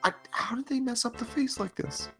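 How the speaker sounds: ordinary talk, nothing marked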